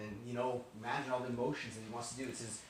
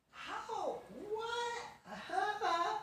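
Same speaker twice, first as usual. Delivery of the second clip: very high-pitched, squeaky disbelief; incredulous; 3 dB quieter than usual. Acted. Brothers cannot explain the seating, incredulous squeak. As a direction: squeak it out in comic astonishment.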